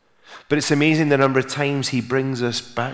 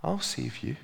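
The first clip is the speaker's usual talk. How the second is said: soft instruction with long pauses; hushed, deliberate voice from above; the Lord; 9 dB quieter than usual. Retold or imitated. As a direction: answer quietly and let each part hang. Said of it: "said the Lord".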